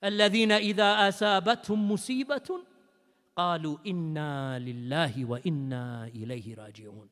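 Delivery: low, rapid recitation, fading away; Quran recitation. Acted. Preacher closing the verse for the grieving, soft fast recitation. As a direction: recite softly and quickly, sinking low.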